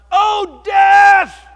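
loud, high shout of "Oh, death!"; yell. Retold or imitated. Preacher at a silent funeral, yelling loudly. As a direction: yell it from the pulpit. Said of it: "he yells out".

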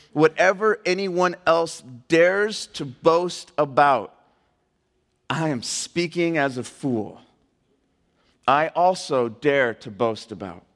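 slow, deliberate verse with long pauses; measured preacher's reading; emphatic scripture reading. Acted. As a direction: read it out weightily, phrase by phrase. Reads scripture aloud to congregation.

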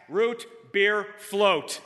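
ROOT BEER FLOAT word by word, very slow and loud; all caps. Retold or imitated. Friend writing his own order, "Root beer float" in slow capitals. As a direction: punch each of "Root beer float" separately, slowly. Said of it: "writes in all caps".

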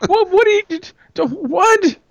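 high, incredulous repeated what; baffled viewer. Retold or imitated. Baffled viewer squealing back at the weird voice. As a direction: squeal your confusion back at him. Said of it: "I'm like".

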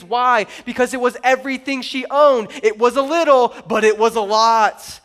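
loud, driving sermon delivery; emphatic preacher, hammering key words; forceful preaching. Real, not acted.

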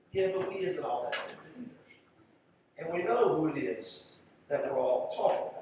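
ordinary talk, nothing marked

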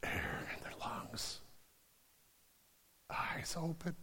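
slow, breathless whisper; hushed whisper. Acted. The pastor whispering the moment the girl wakes. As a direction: hold the hush of a miracle. Pastor describing the girl's revival.

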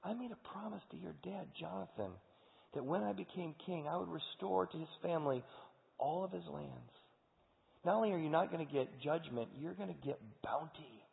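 King David reassuring Mephibosheth with tenderness; gentle reassurance. Acted. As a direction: soothe the frightened man. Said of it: "David turns to him and says".

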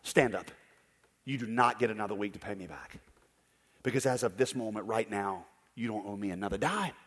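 gently forgive the whole debt, hushed; emotional forgiveness. Retold or imitated. Moved lender softly cancelling the debtor's debt, tender. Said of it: "he gets emotional".

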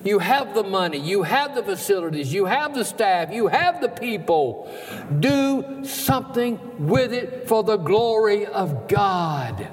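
high, fervent pulpit exhortation; rally the church like a revival preacher; impassioned preaching. Acted. Preaches at full force.

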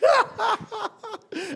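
amused laughter. Real, not acted. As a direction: laugh off a flubbed word. Laughing at own slip.

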